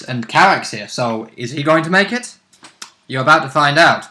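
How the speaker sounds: usual speaking voice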